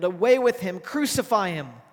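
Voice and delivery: loud mock crowd yell. Leaders yelling at Pilate, loud mock mob shout. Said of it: "they yelled".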